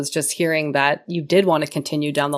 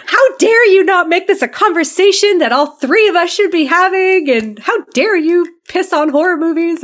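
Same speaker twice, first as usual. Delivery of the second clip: higher, louder mock fury; indignant rant; 11 semitones higher, 5 dB louder than usual. Host retelling how she blasted Rob, high indignant rant. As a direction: scold him like a betrayed friend. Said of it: "And I got pissed".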